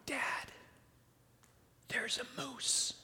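barely audible whispered warning, drawn out; hushed whisper. Retold or imitated. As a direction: imitate a daughter's slow, careful whisper. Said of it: "really quietly she says".

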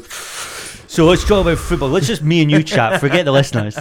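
mocking co-host. Podcaster imitating his co-host on air, mocking. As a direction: tease with an imitated host's pitch. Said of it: "now you're just sat going".

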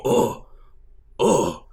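high, slow, whiny orc voice; dim orc giving in. Retold or imitated. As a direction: concede like a dumb orc about to cry. Orcs accept their fate.

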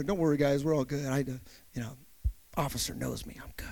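low, hushed, casual aside; murmured reassurance, dropping almost to a whisper; quiet reassurance. Retold or imitated. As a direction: play it cool under your breath. Retold nonchalant reassurance to the kids.

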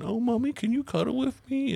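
put-on high, whiny little-kid voice; baby talk. Parent mocking son's baby talk, high whiny kid voice. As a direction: mimic a clingy child asking mommy.

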